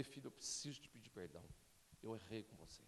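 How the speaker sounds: low, very soft retold apology; pedido de perdão. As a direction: gently own up to your child, hushed. Pai pede perdão ao filho. (confissão terna encenada, grave e baixinha)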